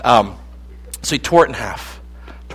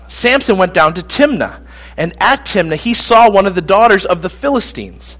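ordinary talk, nothing marked

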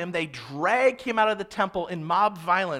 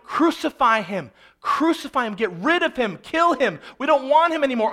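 raised, louder voice of an angry crowd; violent mob cry; 5 semitones higher, 6 dB louder than usual. Acted. Preacher voicing the crowd against Jesus before Pilate, violent insistence. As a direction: demand his death, building higher and more forceful. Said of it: "This is unbelievably violent".

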